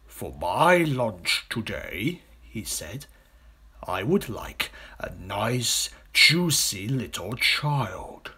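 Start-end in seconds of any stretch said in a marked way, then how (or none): 0.1-2.2 slow, savouring crocodile voice; hungry crocodile. Acted. Big crocodile asking his companion about lunch, slow and relishing. 3.9-8.3 very slow, savouring villain crocodile; relishing crocodile. Acted. Big crocodile announcing his wished-for lunch, slow and relishing.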